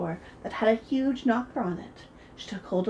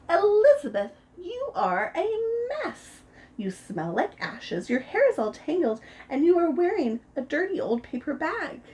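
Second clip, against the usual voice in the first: a lightly voiced disdainful prince, higher; snooty prince; 8 semitones higher, 3 dB louder than usual. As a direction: look down your nose at her. Prince scolds Elizabeth.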